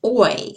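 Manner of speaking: "oi" gliding from o to i; oi sound. Shown as a demonstration. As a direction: glide the "oi" diphthong. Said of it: "You can hear me saying oi".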